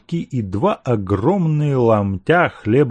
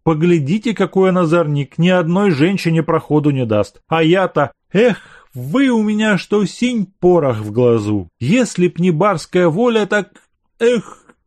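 a lightly voiced old servant, pleading and higher; lovesick servant; 6 semitones higher, 3 dB louder than usual. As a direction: coax her like a heartsick servant. Servant pleads his love.

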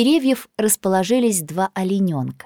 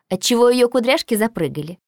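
ordinary delivery, nothing marked

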